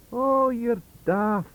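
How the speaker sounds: slow, high, loud Scottish scoff; Scots scoff. Acted. Swinton scoffing at Wilson in a broad Scots voice. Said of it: "cried Professor Swinton".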